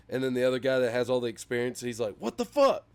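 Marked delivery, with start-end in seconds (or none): 2.2-2.8 much higher and louder, an incredulous outburst; outraged disbelief. Retold or imitated. Experienced worker hears the rookie got hired, high loud outraged disbelief. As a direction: blurt it out like you just got robbed. Meme job seeker loses out.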